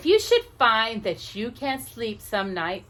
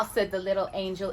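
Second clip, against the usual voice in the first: ordinary delivery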